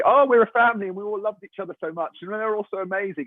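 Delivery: high, gushing luvvie voice; mock gushing actor. Actor mocking cast members' gushing interview praise, high and sugary. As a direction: gush like an actor flattering his cast. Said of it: "when actors do interviews and say".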